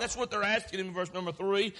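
ordinary delivery

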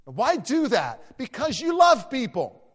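high, loud preacher's cry; preaching shout. Real, not acted. Pastor crying out to his church, loud and high. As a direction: belt it out to the congregation.

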